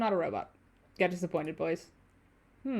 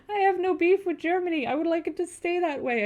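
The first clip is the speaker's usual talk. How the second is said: raised, exasperated voice; loud protest; 10 semitones higher, 7 dB louder than usual. Real, not acted. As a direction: loudly object. Protesting the German jokes loudly.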